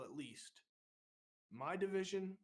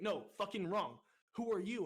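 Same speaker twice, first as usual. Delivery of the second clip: loud, higher mock interrogation; retold angry correction; 5 semitones higher, 5 dB louder than usual. Recruit leader to wrong-answering recruit, loud angry demand. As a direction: re-enact rejecting the answer, then demanding another.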